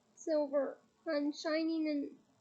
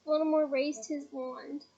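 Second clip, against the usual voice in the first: ordinary delivery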